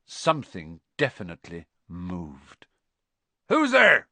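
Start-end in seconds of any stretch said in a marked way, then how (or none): 3.5-4.1 high, loud challenge; calling out. A narrator's reading. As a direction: shout a challenge into the dark. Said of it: "Hagrid called".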